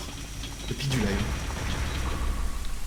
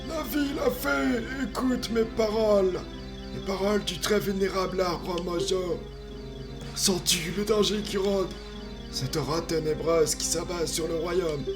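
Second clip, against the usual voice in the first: higher, solemn ancient tree spirit; wise old tree; 8 semitones higher than usual. Acted. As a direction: speak as a grave forest guardian. Voices the Deku Tree.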